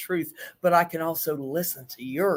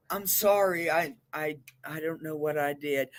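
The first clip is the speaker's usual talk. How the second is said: meek, softer, trailing apology; mock passive apology; 3 semitones lower, 6 dB quieter than usual. Shown as a demonstration. Counselor demos passive talk to group, meek and fading. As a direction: shrink away as you say sorry.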